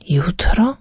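breathless whispered "Jutro?" rising in doubt; whispered disbelief. Acted. Poor man to the stranger, a stunned whispered question. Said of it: "zaprotestował Fortunato".

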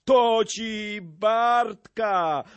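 high, loud, drawn-out exclamation; big hearty shout of support; boisterous brawler. A narrator's reading. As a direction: stretch it out in a rousing cry. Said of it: "rzekł Brzytwa".

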